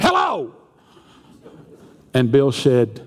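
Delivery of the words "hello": sharply higher, exploding hello; exasperated yell. Acted. Caller woken for the third time, yelling exasperated. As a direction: snap it out at your wits' end.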